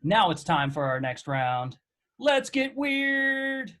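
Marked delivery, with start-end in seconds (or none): 2.2-3.8 drawn-out game show title; slow, stretched announcer call. Acted.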